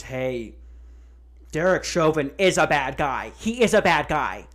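mock the sudden moral crowd, a bit loud; sarcastic imitation of Twitter. Mocking Twitter's sudden outrage.

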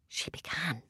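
softly whispered narration. A narrator's reading. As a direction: hush it like a bedtime secret. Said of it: "very quietly".

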